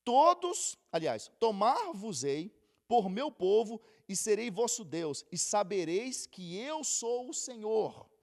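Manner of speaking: loud, slow proclaiming of the verse; scripture reading. Acted. Reads scripture loudly. (leitura bíblica proclamada)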